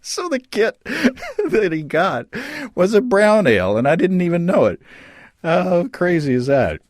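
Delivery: giggling through the words, pitch lifted; can't stop laughing at the coincidence; laughing while telling. Real, not acted. Guest laughing as he tells the host about the kit.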